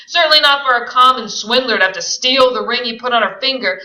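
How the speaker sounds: loud, fast contempt; contemptuous. Acted. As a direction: sneer it at him. Tom contemptuous.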